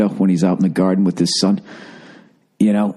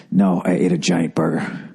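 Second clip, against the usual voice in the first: muffled behind hands, overfull; face in hands; 6 semitones lower than usual. Real, not acted. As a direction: talk into your hands, stuffed. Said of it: "hands over my face".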